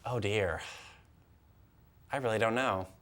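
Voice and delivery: usual speaking voice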